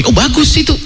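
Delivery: pleased husband. Acted. Husband agreeing happily.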